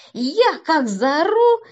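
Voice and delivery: громко, высоко и протяжно; растяни слова, громко показывая, как заорал; изображая крик. A narrator's reading. Рассказчик изображает свой крик. (протяжно, почти криком)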